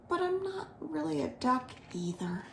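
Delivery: soft, breathy whisper; whispered aside. A narrator's reading. Guji Guji whispering his doubt to himself. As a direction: confide it under the breath.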